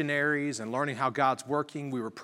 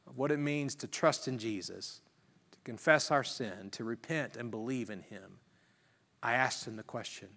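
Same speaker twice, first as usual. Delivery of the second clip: normal talking voice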